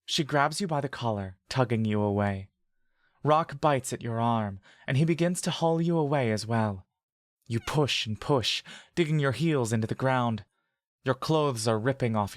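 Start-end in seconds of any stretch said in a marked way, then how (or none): none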